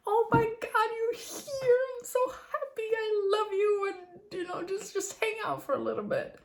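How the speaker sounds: very high, gushing puppy joy; excited dog voice. Pretend. Dog greeting her husband in the morning, high squealing joy. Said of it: "his whole body language is like".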